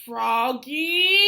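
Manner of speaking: mother calling her son from indoors. A narrator's reading. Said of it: "called his mother".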